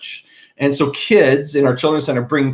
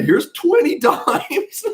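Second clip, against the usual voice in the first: condescending; 9 semitones higher, much slower than usual. Retold or imitated. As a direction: drag it out like to a child. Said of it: "I spoke in that way".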